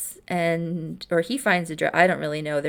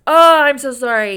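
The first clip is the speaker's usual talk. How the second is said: loud apology; 7 semitones higher, 11 dB louder than usual. Retold or imitated. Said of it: "And he says".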